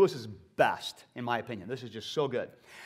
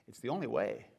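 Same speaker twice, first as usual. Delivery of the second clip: a touch lower and quieter, solemn angel; stern angel; 6 semitones lower, 7 dB quieter than usual. Acted. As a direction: speak as a grave, calm angel. Angel speaks low.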